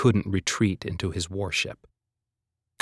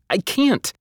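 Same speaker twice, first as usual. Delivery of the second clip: high exasperated voice; frantic; 9 semitones higher than usual. A narrator's reading. Boy explaining the stubborn machine to Hazel, exasperated. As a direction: blurt it out, exasperated. Quarrel mid-battle.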